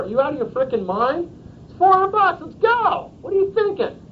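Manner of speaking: very high-pitched mock disbelief; blunt friend-to-friend pressure. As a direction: badger a buddy in exaggerated exasperation. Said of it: "I'm not gonna talk like that to a client".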